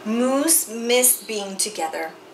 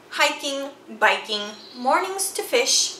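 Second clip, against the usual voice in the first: usual speaking voice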